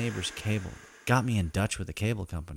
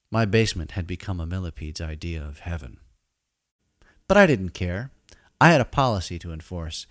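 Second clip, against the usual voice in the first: normal talking voice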